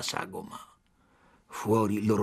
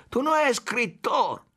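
furious shout; 12 semitones higher than usual. A narrator's reading. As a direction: scream "spione" like a verdict. Chief shouting again.

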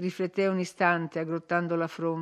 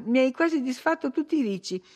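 higher-pitched, peevish scolding; petulant complaint; 6 semitones higher than usual. A narrator's reading. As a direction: fuss at the maid, voice lifted. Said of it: "aggiunse poi con petulanza".

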